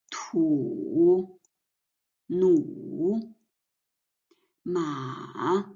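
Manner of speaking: Tu, nu, ma dipping down and back up; dipping third tone. Shown as a demonstration. Teacher showing the third tone, dipping. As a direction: dip each syllable down, then up. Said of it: "first from the left up and down".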